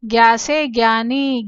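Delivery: ज्ञा से ज्ञानी with ज्ञा said as a hard "gya", slowly; ज्ञानी demonstrated as most people say it, "gyani"; popular gya pronunciation. Shown as a demonstration. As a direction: say ज्ञानी the everyday way, starting with "gya". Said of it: "ज्यादातर लोग इसका उच्चारण कैसे करते हैं".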